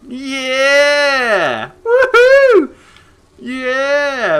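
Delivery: high, loud whooping cheer; excited cheering. Real, not acted. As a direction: whoop it up like you just nailed a trick. Celebrating a cool move.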